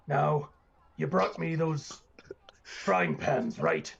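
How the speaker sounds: lightly voiced booming boss. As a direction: talk business with a hint of a big booming voice. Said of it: "it's a really loud, booming voice".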